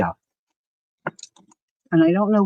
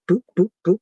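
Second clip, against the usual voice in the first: usual speaking voice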